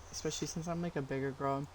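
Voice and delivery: ordinary delivery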